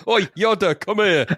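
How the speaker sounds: high cockney shout-out; cocky East End voice. Acted. Riffing on a mispronounced Yoda, cocky East Ender. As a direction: call out like a cheeky East Ender. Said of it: "Yoda had that kind of sort of cocky voice".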